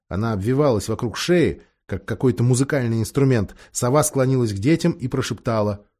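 ordinary talk, nothing marked

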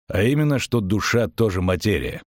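usual speaking voice

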